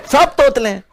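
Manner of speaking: laughing amused remark. Real, not acted. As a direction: giggle the punchline. Laughing after impression.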